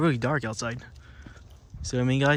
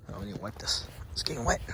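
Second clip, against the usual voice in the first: whispering; 3 semitones lower, 8 dB quieter than usual. Real, not acted. Muttering to himself while fiddling with phone.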